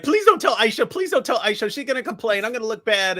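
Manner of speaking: high, pleading mock voice. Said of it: "and he's like".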